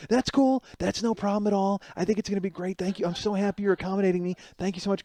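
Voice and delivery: high sugary reassurance; eager high agreeable voice. Retold or imitated. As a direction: play her as overly eager to please. Lily eager to please.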